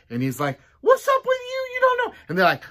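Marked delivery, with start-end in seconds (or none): 0.8-2.1 very high, loud exasperated voice; high exasperated outburst; exasperated squeal. Acted. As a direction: shriek it in disbelief. Job exasperated at friends.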